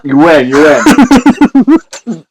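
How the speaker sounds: laughing. Real, not acted. Conceding while laughing hard.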